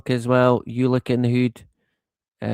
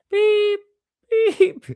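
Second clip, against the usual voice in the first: mock beeping. Imitating a beeping noise.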